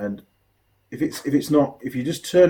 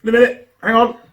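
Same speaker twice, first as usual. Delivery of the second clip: curt waitress; 10 semitones higher, 6 dB louder than usual. Retold or imitated. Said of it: "she's like".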